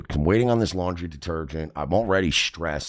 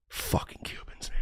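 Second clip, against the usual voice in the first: whispered, disgusted grumble; imitated muttered aside; 12 dB quieter than usual. Friend grumbling about the stranger, hushed mutter. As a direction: say it under the breath.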